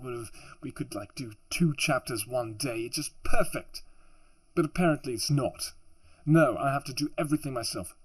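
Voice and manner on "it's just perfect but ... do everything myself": angry, higher-pitched venting; real annoyance. Real, not acted. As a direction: let your irritation burst out. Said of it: "I'm not usually this angry".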